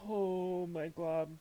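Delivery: overwhelmed, voice cracking with emotion; emotional overwhelm. Real, not acted. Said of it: "I can't".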